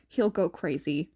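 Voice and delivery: ordinary delivery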